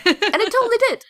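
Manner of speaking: a laugh breaking into the words; giggling it out, pitched high. Real, not acted. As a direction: still laughing as she says it. Laughing at her own story.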